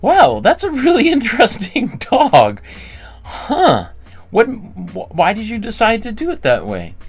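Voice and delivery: a parent marvelling at her daughter's dog drawing; delighted curiosity. Retold or imitated. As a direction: praise the child's drawing with real wonder. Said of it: "I'd say".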